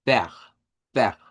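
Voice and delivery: "ver" said as fer, with an open e and a uvular R; German "ver". Shown as a demonstration. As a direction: say "ver" with an F sound and a throaty R. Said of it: "una R uvular". ("ver" con F y R uvular)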